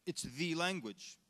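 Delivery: "the" drawn out as "thee", slow; stress on THE. Retold or imitated. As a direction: lean on "the" as if it's the only one. Stresses THE as the only language.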